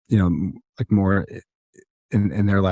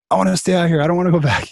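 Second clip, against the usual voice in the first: excited mock whine; 8 semitones higher, faster than usual. Wishing to stay in village.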